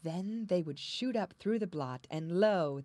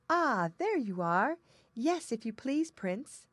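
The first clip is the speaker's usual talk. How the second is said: high, bright and loud; theme park princess; 6 semitones higher, 7 dB louder, slower than usual. A narrator's reading. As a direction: call up gaily like a storybook princess. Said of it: "said the princess".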